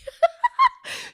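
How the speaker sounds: a sudden high laugh; high burst of laughter. Real, not acted. Podcaster laughs at a cast member's meltdown, high burst of laughter. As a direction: laugh high and sharp at her panic.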